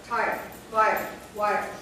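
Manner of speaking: TIRE, FIRE, WIRE, each one loud single beat; one-syllable words. Shown as a demonstration. Teacher showing one-syllable words, separate loud beats. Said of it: "One syllable".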